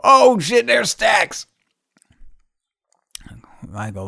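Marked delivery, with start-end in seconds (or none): 0.0-1.5 high, loud oh shit; excited recognition. Retold or imitated. Spotting Stacks on a bicycle, high excited shout. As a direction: point him out with surprised glee. Spots him biking down street.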